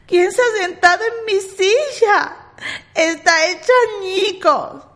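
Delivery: put-on high, tiny baby bear voice; little bear's sweet dismay. A narrator's reading. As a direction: squeak it like a small cub. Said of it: "dijo con vocecilla dulce".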